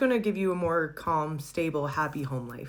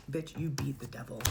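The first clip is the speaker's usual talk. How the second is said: softer, hushed sassy cheer; hushed repeat; 3 semitones lower, 6 dB quieter than usual. Retold or imitated. Reader repeats the cheer hushed after covering up. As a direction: say it again under your breath.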